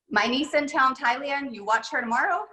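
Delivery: a light put-on Thai accent on broken English. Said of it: "ignore my Thai accent".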